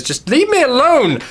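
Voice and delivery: upset outburst. Acted. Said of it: "she says".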